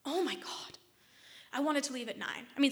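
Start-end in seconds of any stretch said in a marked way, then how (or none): 0.0-0.8 exasperated whisper, a touch higher; disbelieving whispered oh my God; whispered exasperation. Retold or imitated. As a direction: mutter it under your breath in disbelief. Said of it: "I say".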